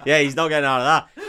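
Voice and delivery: loud, fast laughing remark. Real, not acted. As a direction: laugh through it. Laughing reaction.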